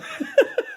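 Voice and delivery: high-pitched burst of laughter; real laugh. Real, not acted. Man joking he'd have murdered him, high laugh. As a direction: crack up at your own boast. Laughs at his own boast.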